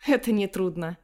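ordinary delivery

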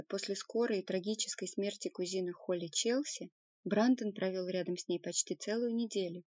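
usual speaking voice

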